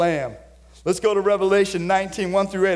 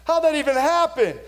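high, incredulous mock wail; bewildered onlooker; 9 semitones higher than usual. Kings crying that Babylon is gone, high and disbelieving. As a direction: mourners gasping at the fall of Babylon. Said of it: "whining and bellowing and like".

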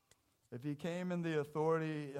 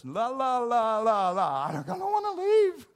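put-on much higher and louder childish la la la; whiny refusal; 9 semitones higher, 15 dB louder than usual. Lazarus refusing to leave paradise, childish whine. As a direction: cover your ears and refuse like a kid.

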